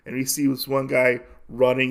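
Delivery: usual speaking voice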